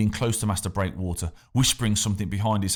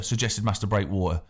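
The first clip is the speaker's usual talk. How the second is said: ordinary delivery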